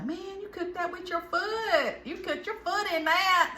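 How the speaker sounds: high, slow hammed-up praise; mock-amazed cook's compliment, drawn out; exaggerated Southern compliment. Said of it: "I'll say".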